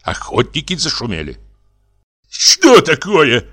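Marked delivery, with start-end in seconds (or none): 2.3-3.5 loud, slow, indignant demand; indignant question. A narrator's reading. Reddened brother to the intruder, indignant question. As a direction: draw it out, high and affronted. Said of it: "спросил покрасневший Егор Егорыч".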